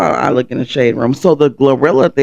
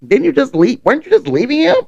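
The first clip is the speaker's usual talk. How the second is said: mocking; 11 semitones higher than usual.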